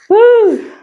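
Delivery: loud laughing woo; excited whoop. Real, not acted. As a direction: let out a high whoop. Exhausted after a set.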